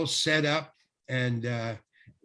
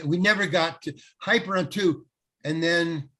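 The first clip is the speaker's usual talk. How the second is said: ordinary talk, nothing marked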